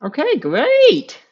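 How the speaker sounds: tired, forced cheerfulness; chirpy fake enthusiasm, worn out; fake positivity. Real, not acted. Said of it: "doing my, like, fake positivity when I'm tired voice".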